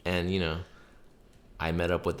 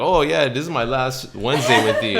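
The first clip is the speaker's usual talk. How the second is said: louder, chuckling through the line; laughing confession; 7 semitones higher, 12 dB louder than usual. Retold or imitated. Skylar admitting her last Wednesday, laughing loudly. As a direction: giggle out the bad news. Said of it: "And then Skylar's like".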